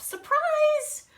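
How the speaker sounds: high, loud Surprise!; big storybook surprise; excited reveal. Acted. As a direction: burst out the reveal to the kids. Repeated reveal in read-aloud.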